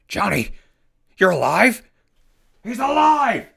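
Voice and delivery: joyful disbelief, rising to a cry; thrilled the boy is alive; overjoyed shout. Acted. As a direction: burst out high and loud with relief. Johnny wakes up.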